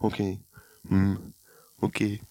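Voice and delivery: soft, low-key one-word answers; quiet, uninterested grunts with gaps; curt mumbled replies. Retold or imitated. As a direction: mimic a man of few words. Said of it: "svarar du väldigt korta grejer".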